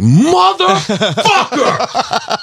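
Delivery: huge high drawn-out yell; furious yell. Retold or imitated. Dad in the elevator yelling at his biting daughter, long high shout. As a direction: blow up at the kid all at once. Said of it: "and I was like".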